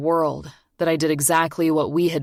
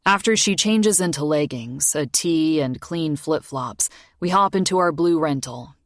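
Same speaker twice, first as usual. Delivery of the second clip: plain reading voice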